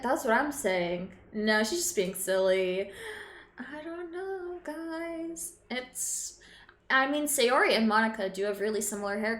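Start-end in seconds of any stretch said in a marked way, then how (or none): 3.6-6.4 1 trailing off. Real, not acted. Soft uncertain murmur.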